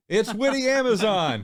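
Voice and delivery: game show announcer. Acted. Host announces contestant.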